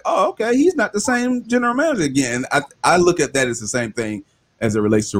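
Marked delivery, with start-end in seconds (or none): 0.0-2.3 high, surprised realization; mock surprise. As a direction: act like you just noticed. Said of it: "and you're like".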